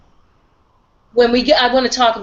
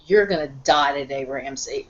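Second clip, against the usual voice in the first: low, terse quote trailing softer; terse retold threat; 5 semitones lower, 4 dB quieter than usual. Retelling Sansa's words to Ramsay, tersely. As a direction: quote a quiet, curt threat, pitched low. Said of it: "very tersely said".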